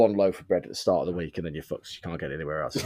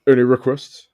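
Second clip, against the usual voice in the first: lightly hammed-up [name], louder than chat; bad [name]; 3 semitones higher, 8 dB louder than usual. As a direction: call out as a half-hearted [name]. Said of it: "that was my bad [name] impression".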